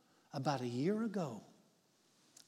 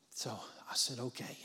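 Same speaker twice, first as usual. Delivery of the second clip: whispered; 5 semitones lower, 9 dB quieter than usual. Real, not acted. Hushed hospital talk with widow.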